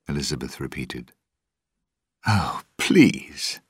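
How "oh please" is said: scornful laugh. A narrator's reading. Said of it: "Norrington laughed".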